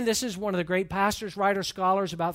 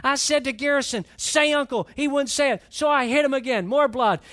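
rising, louder storytelling as the fight peaks; excited retelling; 5 semitones higher, 6 dB louder than usual. Retold or imitated. Preacher reliving the fight for the congregation, louder and excited. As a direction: let the punch land with relish.

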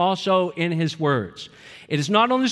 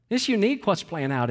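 ordinary talk, nothing marked

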